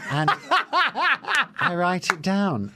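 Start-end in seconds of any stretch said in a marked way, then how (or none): 0.3-1.5 high squealing giggle. Real, not acted. Laughs at own joke.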